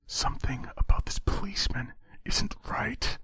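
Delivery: whispered inner warning; a quiet voice of caution in his head. A narrator's reading. Inner voice warning Terry about the cop: whispered. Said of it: "a voice cautioned in the quiet".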